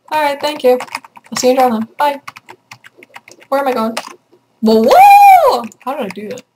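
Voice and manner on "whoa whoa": high loud startled whoa; surprised whoa. Real, not acted. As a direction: yelp in surprise. Sudden surprise in game.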